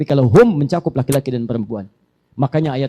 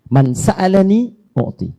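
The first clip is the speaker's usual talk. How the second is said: slow Arabic recitation; 5 semitones higher, 3 dB louder, slower than usual. Shown as a demonstration. Preacher reciting hadith to congregation, slow chant. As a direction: draw out the Arabic slowly, chanting.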